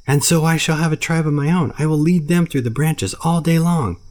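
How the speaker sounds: put-on high, loud excited child's shout; shouting at the top of his voice. Mowgli shouts his grand plan at the bear and panther. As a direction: yell to be heard over them. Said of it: "shouting at the top of his voice".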